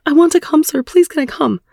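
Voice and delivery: high, urgent begging; blurted plea. Acted. Woman asking her partner's permission, high blurted plea. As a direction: blurt it out as a desperate request, pitched well up. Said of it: "I blurted out".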